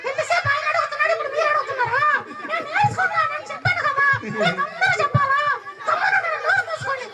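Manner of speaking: mock child talking back to the grown-ups, high and giggly; cheeky boy's voice. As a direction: play the sulky little boy talking back, laughing. Voicing the boy's cheeky reply.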